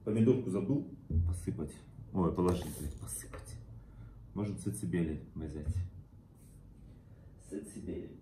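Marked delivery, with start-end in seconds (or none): 2.8-3.6 whispered swear under breath; muttered curse. Real, not acted. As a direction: mutter it to yourself.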